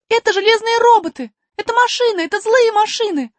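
put-on high loud urgent girl voice. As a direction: warn them in alarm. Alice warns dolphins urgently. (взволнованный крик)

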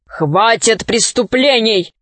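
ordinary delivery

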